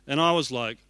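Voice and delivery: usual speaking voice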